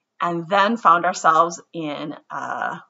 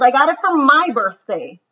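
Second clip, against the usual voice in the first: put-on louder, higher childish insisting; insisting lie; 7 semitones higher, 7 dB louder than usual. Ruthie lies to the teacher, loud and higher.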